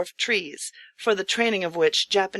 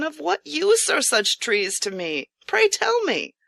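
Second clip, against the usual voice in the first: rueful question; 7 semitones higher than usual. Acted. Ruined host's rueful question.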